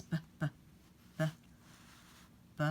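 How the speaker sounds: soft b pops; teacher sounding out "b"; phonics sound. Shown as a demonstration. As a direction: say "b" as a bare pop. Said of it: "what the beginning is".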